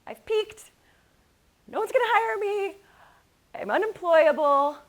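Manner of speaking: high, loud, despairing cry of giving up; exasperated outburst. Retold or imitated. Husband home from a bad interview, exasperated loud outburst. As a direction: blurt it out like a man at the end of his rope. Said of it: "throwing his hands in the air and saying".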